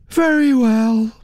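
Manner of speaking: high-pitched words stretched through a yawn; indifferent, yawned reply. A narrator's reading. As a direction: yawn out a bored agreement. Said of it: "Naomi yawned".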